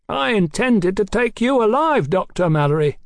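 high, projected pronouncement; finality. A narrator's reading. Captain gives up on sparing the doctor, final. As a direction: pronounce it as a last word. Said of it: "in a voice of finality".

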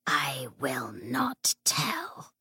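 slow, soft breathy refusal; whispering. Acted. Ghost refuses.

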